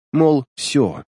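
plain reading voice

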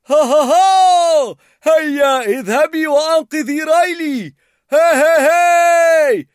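high, loud, slow chanting laughter; happy laughing song. A narrator's reading. Bing Bong sings happily, laughing as he fades. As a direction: laugh and sing with pure delight. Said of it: "كان يغني بسعادة".

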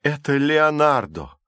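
high, drawn-out cry of dismay; moaned exclamation. A narrator's reading. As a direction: cry out in shocked recognition. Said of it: "простонала Калипсо". (протяжный горестный возглас)

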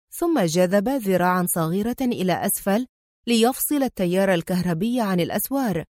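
ordinary delivery

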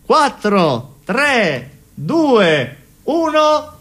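high, ringing countdown calls, each number falling away; New Year countdown. Acted. New Year countdown shouted on stage.

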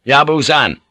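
urgent hail; calling out. A narrator's reading. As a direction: call across to get his attention. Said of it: "Blackthorn called out".

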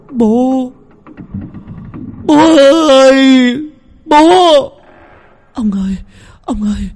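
high, loud anguished wail; horrified wailing. A narrator's reading. Mother and sister seeing the father's corpse, wailing high and loud in horror. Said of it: "kinh hãi gào lên".

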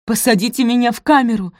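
high, loud cry; shouted plea. A narrator's reading. Posy demanding to be locked up, shouting. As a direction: call out urgently over everyone. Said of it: "крикнула Пози".

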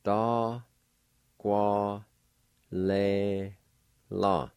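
da, Kwa, le, la each sounded out on its own; teacher sounding out da Kwa le la; syllable by syllable. Shown as a demonstration. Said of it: "Number two is pronounced like this".

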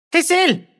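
high, piercing cry; sharp shriek of recognition; shout. A narrator's reading. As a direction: cry out as she spots him. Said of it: "gritó Remedios".

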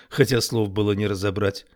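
usual speaking voice

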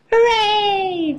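high, drawn-out gleeful cheer. A narrator's reading. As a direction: let out a child's high hooray. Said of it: "cheers Peppa".

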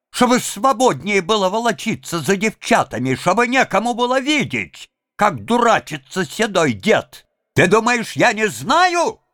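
put-on a jealous sister-in-law tearing into the old man; furious scolding woman. A narrator's reading. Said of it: "вскричала она".

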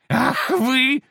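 high shrieking outrage; outraged devil. Acted. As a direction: spit it like a cheated demon.

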